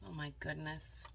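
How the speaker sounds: plain reading voice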